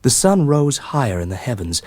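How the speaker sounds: ordinary delivery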